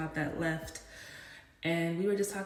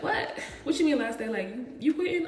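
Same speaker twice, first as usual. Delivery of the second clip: high, startled questioning; retold shock; 7 semitones higher, 3 dB louder than usual. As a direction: imitate a coworker caught off guard, pitch jumping up. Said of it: "she was shocked".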